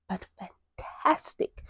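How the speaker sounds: softly whispered aside; a slow, whispered but fantastic. Pretend. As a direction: whisper it like a secret. Breathy aside.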